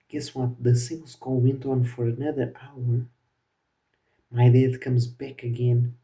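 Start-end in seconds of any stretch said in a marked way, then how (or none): none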